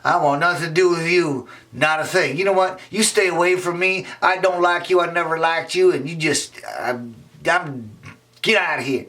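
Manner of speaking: put-on high scornful girl voice; girl turning on him. Emily rejects Raymond, high and spiteful.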